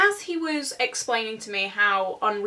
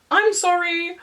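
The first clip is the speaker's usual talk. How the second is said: high, louder drawn-out sorry; awkward mock apology; 7 semitones higher, 5 dB louder than usual. Date apologising to an angry stranger, high mock-awkward. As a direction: offer a pitched-up, cringing apology.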